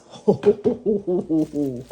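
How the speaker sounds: giddy "hoo-hoo-hoo" laugh; excited hooting laugh. Real, not acted. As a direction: laugh in a run of delighted hoots. Excited by the loaf's weight.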